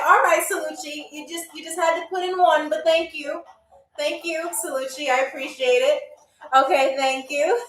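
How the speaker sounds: ordinary talk, nothing marked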